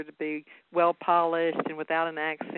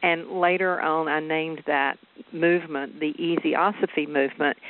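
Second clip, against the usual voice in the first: ordinary talk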